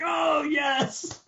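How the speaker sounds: high excited "oh yes" shout; mock delighted yell. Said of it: "I was like".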